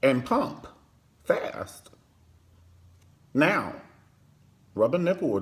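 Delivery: a light high sing-song coax; mock sultry mom. Mother instructing Jeffrey, lightly mocking and high. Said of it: "She was like".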